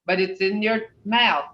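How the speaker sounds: ordinary talk, nothing marked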